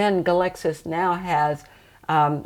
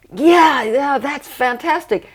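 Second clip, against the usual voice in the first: voiced high and loud, thrilled; an excited yes from a client; 8 semitones higher, 5 dB louder, slower than usual. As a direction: burst out with delighted agreement. Client reacting to a reading.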